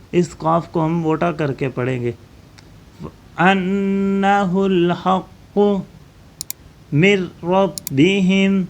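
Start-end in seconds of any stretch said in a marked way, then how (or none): 3.4-8.6 انہ الحق من ربہم recited slowly, the ن of من dropped into ربہم; slow tajweed model, merge من into ربہم; slow recitation demo. Shown as a demonstration. Teacher models merging the nun for pupils, slow recitation. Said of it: "نون ساکن ہے".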